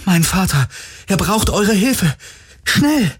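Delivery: high, pressing cry for help; urgent plea. Acted. Heroine tricking guards into helping her father, urgent plea.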